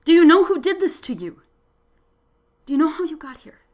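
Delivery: loud, urgent questions; urgent questioning. A narrator's reading. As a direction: press her for answers.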